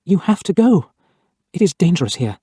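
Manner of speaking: a touch of sadness as she begs him to go; Mary gently telling him he must leave, a little sad. A narrator's reading. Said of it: "said again in a sad voice".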